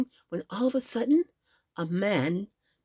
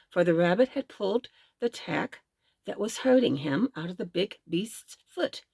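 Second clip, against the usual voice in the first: ordinary delivery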